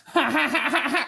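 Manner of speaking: high goofy giggle, imitating Sandler; hammed-up Adam Sandler laugh; Sandler laugh impression. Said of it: "your laugh, where you go".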